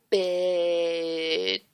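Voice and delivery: drawn out over many syllables; sneering "Bitch" dragged out long; stretched-out insult. A narrator's reading. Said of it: "stretching the word out".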